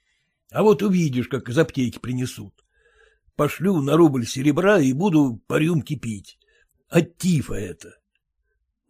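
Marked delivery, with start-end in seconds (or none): none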